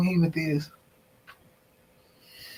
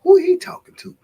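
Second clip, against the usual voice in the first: higher, rising, puzzled questions; imitating a puzzled newcomer; 12 semitones higher, 4 dB louder than usual. Newcomer wondering who the streamer talks to, mock puzzled. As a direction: play a newcomer baffled by the chat. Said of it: "Probably was over there saying this".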